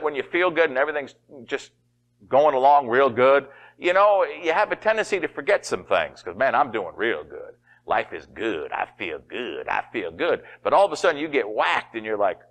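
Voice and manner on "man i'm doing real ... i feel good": low, easygoing self-satisfied voice; mock laid-back contentment; lightly acted contentment. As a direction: play someone coasting along, softer and lower. Complacent person before trouble hits.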